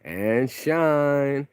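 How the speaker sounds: very drawn-out morning call; show-opener wake-up. Pretend. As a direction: stretch each word out like a wake-up announcement.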